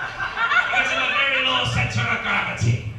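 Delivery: put-on loud, high, drawn-out mangled Scottish accent; badly faked Scottish. Co-commentator analysing the racer's weight, loud mangled Scottish. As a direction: stretch it out as a bad Scottish pundit. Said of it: "Imagine he sounds Scottish".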